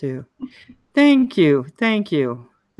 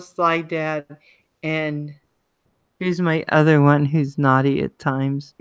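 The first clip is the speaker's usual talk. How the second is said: normal talking voice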